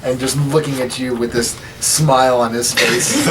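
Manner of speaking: usual speaking voice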